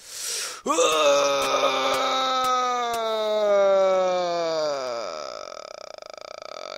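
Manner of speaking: high wail sliding down into a creak; long falling wail. Real, not acted. Gamer cries out as his plan fails, long falling wail. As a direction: let out a long falling cry. Cry after failed attempt.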